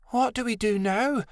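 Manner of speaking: high, worried youngster voice; helpless. A narrator's reading. Adelaide asks the others for a plan, helpless and high. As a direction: ask helplessly, pitched high.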